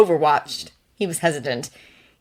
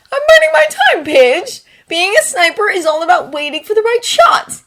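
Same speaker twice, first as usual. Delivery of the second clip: defensive gamer; 14 semitones higher, 9 dB louder than usual. Acted. Gamer defending his sniping.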